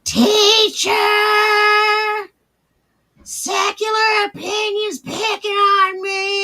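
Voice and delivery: high, loud tattletale wail; hammed-up crybaby voice tattling; mock whiny child. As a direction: whine like a kid running to the teacher.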